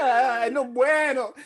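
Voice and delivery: high, slow, laughing sob of Spanish; mock Spanish melodrama. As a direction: sob it like a telenovela hero. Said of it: "it was so dramatic".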